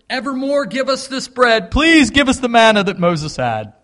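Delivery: loud, high-pitched begging; pleading crowd. Acted. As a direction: act out the people clamoring for bread. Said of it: "That's what they're saying".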